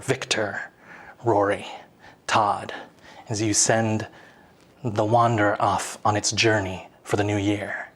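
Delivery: low, hushed, solemn narration; game master's scene setting. Acted. Game master asks players for a New Year wish, low and solemn.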